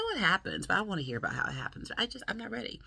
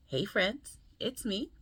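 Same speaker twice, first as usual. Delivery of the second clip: ordinary talk, nothing marked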